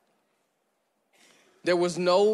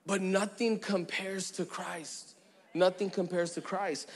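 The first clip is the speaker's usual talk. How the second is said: soft conviction; 7 dB quieter than usual. Acted. Preacher quietly telling the congregation nothing compares, hushed. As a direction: drop to a hush. Soft climax after list.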